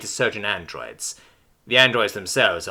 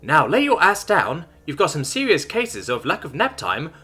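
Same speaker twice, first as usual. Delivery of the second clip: a stoner android, totally relaxed but brash; bold chill bro; 6 semitones higher than usual. A narrator's reading.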